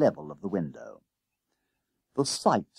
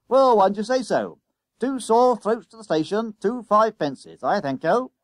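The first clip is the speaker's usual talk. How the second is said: high, loud conductor patter; loud bus conductor; 11 semitones higher, 6 dB louder than usual. A narrator's reading. Conductor issuing tickets to two schoolboys, loud patter. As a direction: rattle off the fare loudly.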